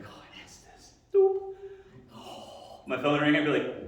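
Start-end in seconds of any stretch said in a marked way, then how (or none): 0.0-0.9 breathy, voiceless sigh of words; barely-there, blissful whisper; sighing relief. Acted. Savoring clocking out at work. 1.1-1.5 high-pitched electronic beep imitation; high "doop" beep; imitated beep. 2.2-2.8 long, breathy sigh of relief; acted sigh of bliss; relieved sigh.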